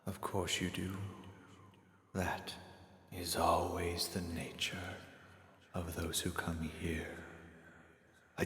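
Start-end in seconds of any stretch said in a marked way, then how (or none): 0.1-7.2 soft, slow, half-whispered stranger; quiet, slow, breathy-free whispered calm; mysterious hooded stranger. Acted. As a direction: hush it like a figure in the dark. Hooded stranger Toll speaks.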